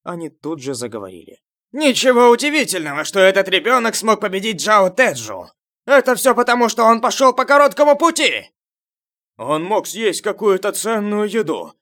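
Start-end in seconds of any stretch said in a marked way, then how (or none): 1.7-8.5 high, loud, scornful outburst; loud jeering henchman. A narrator's reading. Henchmen backing their boss, loudly dismissing the boy's win. Henchmen chime in to discredit Июнь. 9.4-11.7 raised, high accusing voice; loud accusing henchman. A narrator's reading. Henchman explaining away the boy's strength, loud and accusing. Another henchman discredits Июнь.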